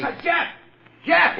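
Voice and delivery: high urgent call across the yard. Acted. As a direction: call him from a distance. Calling out to partner from outside.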